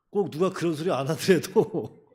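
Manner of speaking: real laughter creeping into the words; amused. Real, not acted. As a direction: exhort them while starting to laugh. Breaks into laughter while exhorting congregation.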